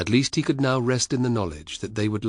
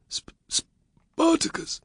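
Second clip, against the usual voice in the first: slow, breathless stammer of the name; stammering in fear; much slower than usual. A narrator's reading. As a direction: choke on the name in disbelief. Varus stammers the dreaded name.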